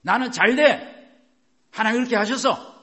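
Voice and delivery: loud, high, emphatic confession; shouted declaration. Shown as a demonstration. Preacher modelling a confession for congregation, shouted. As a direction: proclaim it forcefully at full volume. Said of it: "이렇게 말씀하시라고요".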